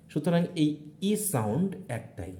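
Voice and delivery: usual speaking voice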